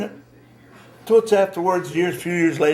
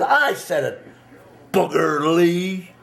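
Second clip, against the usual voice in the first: drawn-out taunt on the name; giving it to him; 3 semitones higher, much slower than usual. Retold or imitated. Young man repeating the insult to Booger's face, defiant. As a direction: stretch the nickname out slowly to goad him. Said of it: "I give it to him now".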